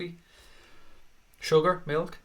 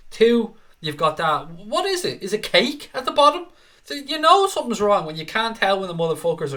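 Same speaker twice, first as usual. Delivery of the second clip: high, loud, incredulous comic outrage; exasperated, indignant ranting; worked-up rant; 4 semitones higher, 5 dB louder than usual. Real, not acted. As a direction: get worked up over something trivial. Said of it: "I'm getting so worked up here".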